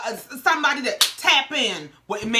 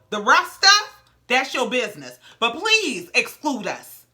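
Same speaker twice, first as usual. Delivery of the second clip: usual speaking voice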